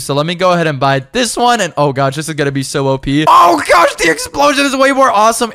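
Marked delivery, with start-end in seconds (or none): 3.3-5.5 excited shout. Real, not acted. To friends as the new bomb goes off, excited shout. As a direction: yell with delight at the blast.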